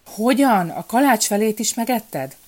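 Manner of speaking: a king scolding his courier in disbelief; outraged royal accusation; angry king. A narrator's reading. King confronts the thieving courier.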